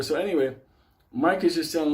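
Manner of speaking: ordinary talk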